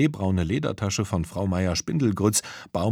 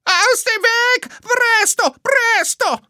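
shrill, very high panicked screech; high-pitched crying shriek of a panicking rider; 7 dB louder, slower than usual. A narrator's reading. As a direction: scream it from a speeding motorbike. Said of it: "kreischte nur noch".

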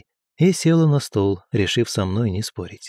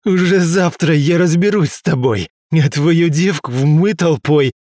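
vicious threat; 5 semitones higher, 4 dB louder than usual. Acted. Beaten aristocrat threatens.

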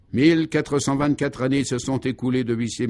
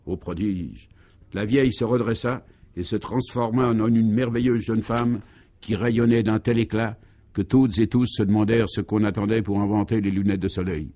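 ordinary delivery

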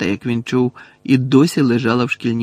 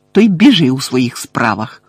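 louder, angry dismissal; restrained furious outburst; furious exclamation; 6 dB louder than usual. A narrator's reading. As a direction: send them away with heat. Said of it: "люто вигукнула Чо".

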